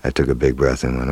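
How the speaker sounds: ordinary delivery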